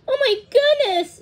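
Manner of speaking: very high, loud gasp of alarm; exclaiming. A narrator's reading. Thumbelina learns of the thorn, exclaiming in alarm. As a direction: exclaim in high-pitched shock. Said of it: "exclaimed Thumbelina".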